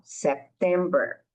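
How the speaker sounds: September with no extra vowel after the P; teacher showing the fix; correct September. Shown as a demonstration. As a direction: say it right, sep-tember. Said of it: "Não, não".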